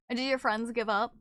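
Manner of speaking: usual speaking voice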